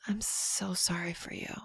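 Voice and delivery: imitated breath-only condolence; whispered sympathy.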